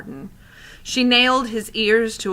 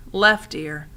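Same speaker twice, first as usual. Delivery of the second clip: plain reading voice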